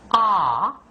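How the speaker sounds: ah with the jaw dropped wide open. Shown as a demonstration. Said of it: "nice open jaw".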